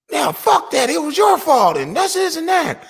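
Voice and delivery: high-pitched mimicked online squabbling; cursing gamer kid. Son cursing at friends in a game chat, high mock argument. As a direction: bicker way up high like a teen gamer. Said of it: "I hear somebody cursing".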